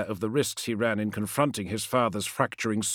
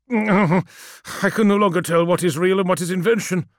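raised, higher, anguished voice; confused, distressed confession; despairing; 6 semitones higher, 5 dB louder than usual. Acted. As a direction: lose your grip aloud. Magnus despairs of his mind.

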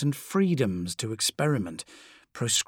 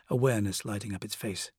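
ordinary delivery

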